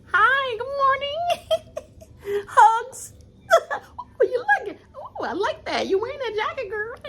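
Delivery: high squealing fake-friendly voice, laughing through it; mock fake-sweet greeting. Mocking Danielle greeting Carolyn in a high fake-sweet squeal. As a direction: gush with exaggerated sweetness.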